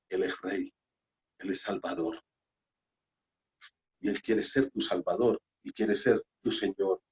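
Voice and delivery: softly, as if awed before God; hushed reverence. Real, not acted. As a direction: drop to a soft, reverent hush. Reverent declaration of who God is.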